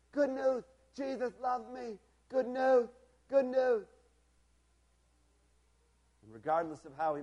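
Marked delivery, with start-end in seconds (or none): 0.1-3.9 high, repeated excited calls; Bernie's receding cries. A narrator's reading. Bernie running to the other side of camp, excited cries. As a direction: call out high like a boy running off with news. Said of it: "I can still hear his voice getting fainter".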